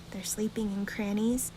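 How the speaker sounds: plain reading voice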